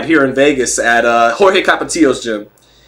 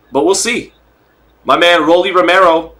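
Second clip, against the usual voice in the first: ordinary delivery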